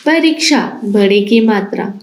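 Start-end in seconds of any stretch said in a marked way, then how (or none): none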